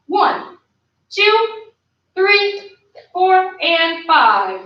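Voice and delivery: high, evenly spaced coach's count; drill count. Real, not acted. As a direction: count out the hold, drawing out the last beats. Counting out a held kick.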